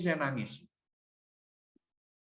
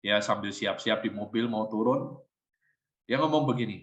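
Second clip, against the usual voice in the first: usual speaking voice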